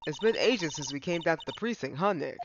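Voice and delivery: ordinary delivery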